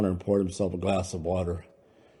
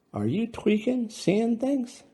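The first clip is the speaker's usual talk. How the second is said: much higher, anxious concern; alarmed, pleading to know; worried; slower than usual. Acted. Louis asking Brando if he's high, worried and high-pitched.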